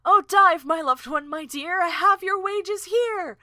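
high, loud, theatrical reply; Celandine. Acted. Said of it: "replied to by Celandine".

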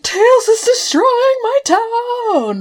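high, slow, badly faked German accent. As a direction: ham up a bad German accent. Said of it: "But in a German accent".

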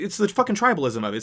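ordinary talk, nothing marked

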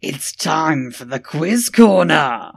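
loud, slow game show announcer. Acted. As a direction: announce the segment with fanfare. Segment announcement.

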